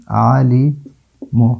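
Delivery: علیم very slowly, as "a-lee... mu"; syllable-by-syllable pronunciation. Shown as a demonstration. Teacher sounding out the word after al, slow broken-up علیم. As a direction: stretch علیم and set the last syllable apart. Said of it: "لام کا تلفظ ہوتا ہے".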